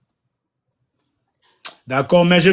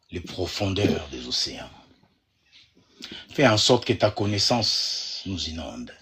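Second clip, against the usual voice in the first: prayer; 8 semitones lower, 4 dB quieter than usual. Real, not acted. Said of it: "Je vais juste prier pour tout le monde".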